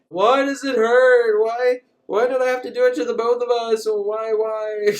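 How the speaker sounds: milk the dying lover's grief; mock tragic lament. Dying alien woman laments, high hammed-up wail. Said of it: "She's like".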